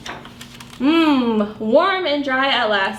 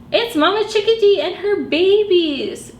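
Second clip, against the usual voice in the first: ordinary delivery, nothing marked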